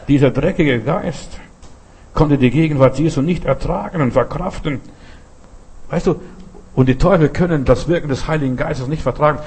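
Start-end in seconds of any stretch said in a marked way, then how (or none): none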